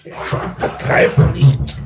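breathy laugh through the words, slow. Acted. Husband to wife, laughing off her warning. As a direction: laugh her worry away. Husband brushes off wife's worry.

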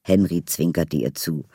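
normal talking voice

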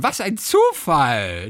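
drawn-out, gushing exclamation; what-a-coincidence gush, hammed up; mock delighted surprise. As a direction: stretch it out in playful amazement. Acted gushing surprise greeting in story.

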